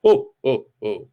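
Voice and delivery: a hint of Santa's ho ho ho; stiff jolly laugh. A narrator's reading. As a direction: Death forcing out the laugh. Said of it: "Jolly good".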